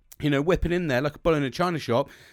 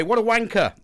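mocking insult; 7 semitones higher, 7 dB louder than usual. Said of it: "that's what I got called".

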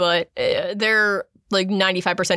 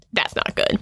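mock panicked whisper. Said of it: "Charles freaks out and is like".

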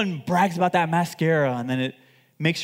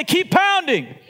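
shouted, much higher and louder; 11 semitones higher, 6 dB louder, slower than usual. Shown as a demonstration. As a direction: belt it out to the crowd. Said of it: "say it a little loud".

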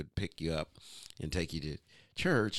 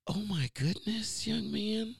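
high, breathy-sounding prim lady exclaiming; church lady voice; 13 semitones higher, slower than usual. Retold or imitated. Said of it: "that is how Caucasian ladies talk".

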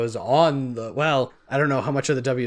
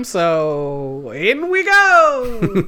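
drawn out; 4 semitones higher, 4 dB louder, much slower than usual. Real, not acted. Drawn-out playful resignation.

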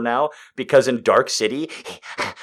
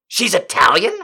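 quoting a movie line; 8 semitones higher, 3 dB louder than usual. Retold or imitated. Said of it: "And Steven Weber's goes".

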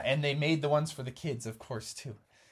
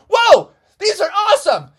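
put-on thrilled kids; 15 dB louder than usual. Team won over.

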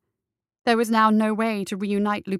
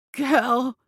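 hissed; 3 semitones higher than usual. Acted. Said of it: "he hissed again".

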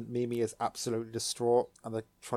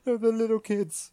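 very high and loud wail; mock anguish; 11 semitones higher, 5 dB louder than usual. Real, not acted. Fan upset over the kids parting, high anguished wail. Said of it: "And I'm like".

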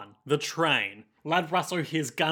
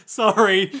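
high, loud quick "sorry" while bowing out; mock apology; 4 semitones higher, 8 dB louder than usual. Outgunned Nick backs out the door, mock-apologetic. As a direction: sing out an apology on the way out.

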